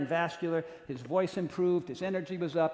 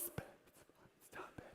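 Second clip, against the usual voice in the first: stunned whisper; 26 dB quieter, much slower than usual. Retold or imitated. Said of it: "So I'm like".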